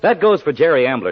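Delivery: ordinary talk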